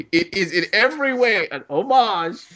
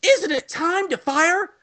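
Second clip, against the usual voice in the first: higher, slower, hammed-up crewman asking the captain; eager bridge officer; 10 semitones higher, slower than usual. Crewman urging the captain, hammy and high. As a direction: ham up an eager officer's nudge. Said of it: "someone on the bridge will go".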